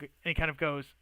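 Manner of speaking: ordinary talk, nothing marked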